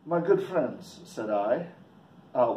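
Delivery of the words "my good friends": lightly put-on Swiss accent; polite stranger with a hint of Swiss accent; foreign accent. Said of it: "keep in mind he has a accent".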